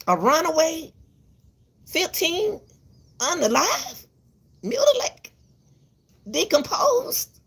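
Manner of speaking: high-pitched, word by word with pauses; appalled listing. Real, not acted. Mother listing children's fates to viewers, appalled.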